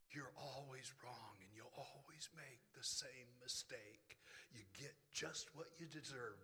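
low, whispered accusing inner voice; voice of dead me. Acted. The dead old self accusing the believer, low whispered taunt. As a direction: hiss his old self's lies at him. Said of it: "It was the voice of dead me".

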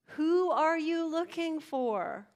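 high, loud, slow question; emphatic question. Acted. Risen Jesus to a searching woman, emphatic. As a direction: ring it out, stretching the words.

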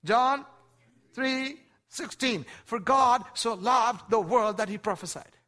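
loud, high, ringing preacher's voice; full-force sermon climax; preaching at full force. Acted. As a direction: drive the point home to the congregation.

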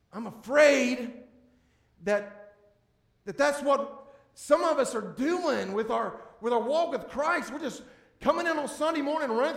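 a pastor warns the congregation, preaching hard. Acted. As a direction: press the warning on the congregation. Preacher building his point.

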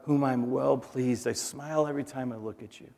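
dropping soft, gently affectionate; tender voice of God. Acted. God speaking over his children, soft and tender. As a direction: say it softly, as a loving father.

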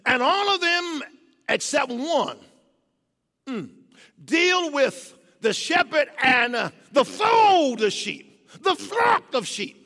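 loud, high, drawn-out preacher's cry; full-force preaching. Acted. Preacher building the congregation to a loud climax. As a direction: preach it out to the rafters.

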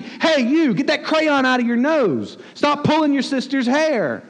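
high, drawn-out scolding; parent's mock rebuke. Parent to toddler in the back seat, mock telling-off. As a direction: scold like an exasperated parent, stretching each order. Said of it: "rebuke is what you do to your toddler".

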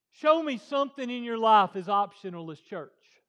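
loud, high preaching shout. Real, not acted. Preacher challenging the congregation, shouting. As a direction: hammer the point home at full volume.

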